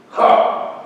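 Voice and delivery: loud, scornful "Ha!"; scoff. A narrator's reading. Pilate answers the claim of kingship, scoffing loudly. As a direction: scoff at them openly. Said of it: "scoffed Pilate".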